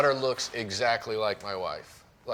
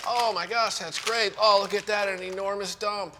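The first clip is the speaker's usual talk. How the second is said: high-pitched, over-the-top parental delight; gushing praise; 9 semitones higher, 3 dB louder than usual. Retold or imitated. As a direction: cheer a toddler on, hammed-up. Retold dad praising potty success.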